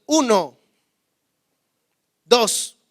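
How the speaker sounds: put-on loud, firm count to a child; slow, loud warning count to a son. Shown as a demonstration. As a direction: count it out like a stern parent. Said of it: "yo no tengo que gritarle".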